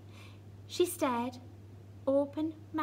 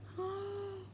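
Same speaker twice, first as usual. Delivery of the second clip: soft shocked gasp; hushed gasp; 8 semitones higher, 7 dB quieter than usual. Acted. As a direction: gasp quietly in fright. Seeing whose house it is.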